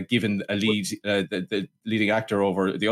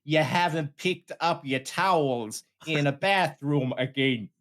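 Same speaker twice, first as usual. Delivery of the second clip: slow, drawn-out mock accent; hammed-up scolding flatmate, words stretched; sitcom Kane; 3 semitones higher, slower than usual. Kane nags Larry about towels, slow put-on accent.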